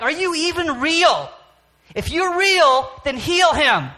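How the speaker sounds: high, loud, desperate challenge; retold yelling at God. A father screaming at God to heal his son, loud and desperate. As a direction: shout it up at the sky, daring Him. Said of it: "I yelled and screamed at him".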